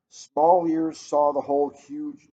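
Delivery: ordinary delivery, nothing marked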